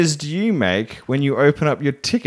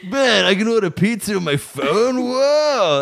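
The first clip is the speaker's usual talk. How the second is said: high, amazed stoner drawl; 9 semitones higher, slower than usual. Retold or imitated. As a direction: marvel at the phone like a stoner. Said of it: "That's what it sounds like".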